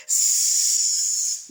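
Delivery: a long unvoiced snake hiss. Shown as a demonstration. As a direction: hiss through the teeth like a serpent. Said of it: "like a snake".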